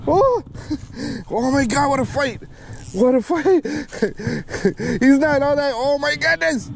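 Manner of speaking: high, breathless thrill with laughter; giddy, laughing at the fight; excited, laughing. Real, not acted. Landing a hard-fighting fish.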